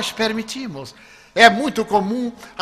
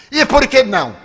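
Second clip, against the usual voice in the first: high, loud demand; retold indignant retort; 6 semitones higher, 5 dB louder than usual. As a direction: fire the question back crossly. Angry stranger demands an explanation. (réplica indignada, aguda e alta)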